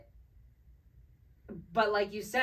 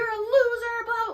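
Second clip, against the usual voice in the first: high, loud retold scream; mock furious scream, pitched way up; imitated screaming; 7 dB louder than usual. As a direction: yell it like the friend screaming after someone. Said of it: "like, screaming at this person".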